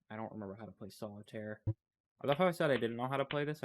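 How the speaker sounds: ordinary delivery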